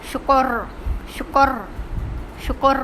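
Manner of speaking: শুকর with a rolled, heavy final R. Shown as a demonstration. Teacher shows students the pronunciation, শুকর with heavy R. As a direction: hold the trilled R ending শুকর. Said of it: "উচ্চারণটা হবে".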